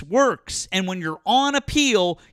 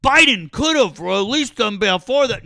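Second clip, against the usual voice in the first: louder mock imitation of an opponent; mocking opponent's objection; 3 semitones higher, 5 dB louder than usual. Debater parrots the caller's expected objection, mocking. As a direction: throw their objection back louder, mockingly. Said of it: "you would say".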